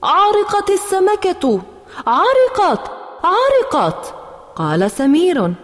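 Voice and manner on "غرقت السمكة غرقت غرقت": high alarmed shout with gh said as ain; loud cry. Acted. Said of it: "صرخت بأعلى صوتي". (صرخة طفلة مذعورة: عرقت السمكة)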